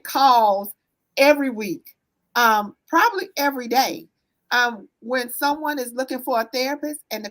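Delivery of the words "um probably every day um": ordinary delivery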